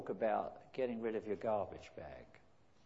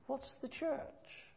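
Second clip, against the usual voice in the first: high, drawn-out question; slow high probing question; 4 dB quieter, much slower than usual. Retold or imitated.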